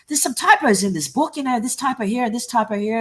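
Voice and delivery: mock customer complaint; imitated complaint. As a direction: list the faults like a fussy customer. Retelling an irate customer's complaints.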